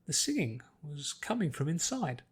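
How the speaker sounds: usual speaking voice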